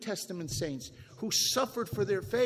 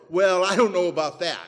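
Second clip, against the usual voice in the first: louder hammed-up dodge of a lukewarm believer; 12 dB louder than usual. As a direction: parody the shrugging churchgoer.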